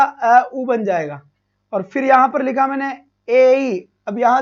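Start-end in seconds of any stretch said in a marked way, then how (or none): 0.0-1.2 अ, उ stretched very slowly; slow vowel repeat. Shown as a demonstration. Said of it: "क्या बन जाएगा". (अ, उ को धीरे-धीरे दोहराना)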